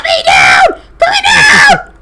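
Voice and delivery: loud, drawn-out creature shriek climbing very high; panicked screech of a held little creature; yelling ice mephit. Acted. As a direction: yell it frantically, screeching at the end. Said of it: "will continue yelling".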